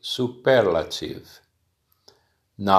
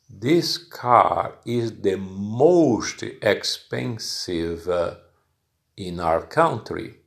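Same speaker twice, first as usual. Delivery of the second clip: slow example sentence. Shown as a demonstration. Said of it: "I am using the superlative".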